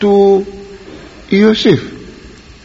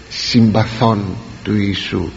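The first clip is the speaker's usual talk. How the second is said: ordinary talk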